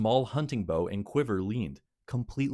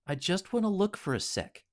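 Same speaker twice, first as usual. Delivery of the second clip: put-on girl voice; 11 semitones higher than usual. Riley's girl voice.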